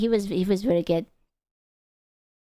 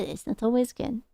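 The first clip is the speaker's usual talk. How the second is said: usual speaking voice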